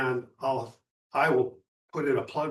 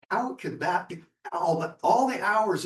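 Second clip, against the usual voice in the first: retold amazement, pitched higher; wondering; 7 semitones higher, 4 dB louder than usual. Telling the group about the beautiful moment, wondering. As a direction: marvel back at it, higher.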